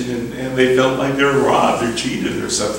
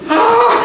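mock scream; 6 dB louder than usual. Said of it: "Customers going".

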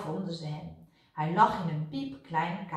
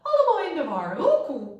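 put-on high, loud cooing old dove; astonished turtledove; 8 semitones higher, 7 dB louder than usual. A narrator's reading. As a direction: coo it in dismay, swooping down from high. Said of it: "Ze schudde verwonderd haar hoofdje".

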